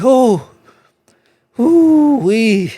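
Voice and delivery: very high, loud squeals; hammed-up high whoops; mock squeals of relief. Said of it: "He was like".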